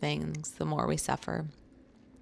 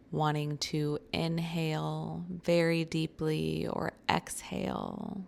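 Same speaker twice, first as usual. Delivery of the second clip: slow, calm meditation voice with long pauses; guided meditation; slower than usual. Real, not acted. Guiding listeners through a short breathing meditation, slow and calm. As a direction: ease the listener into noticing their breath, unhurried.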